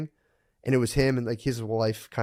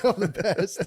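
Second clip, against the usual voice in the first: smug mock; 7 semitones higher than usual. Mocking fighter's smug victory.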